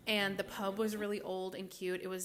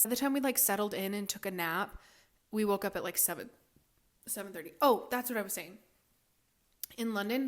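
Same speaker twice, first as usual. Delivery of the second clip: usual speaking voice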